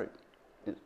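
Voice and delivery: ordinary talk, nothing marked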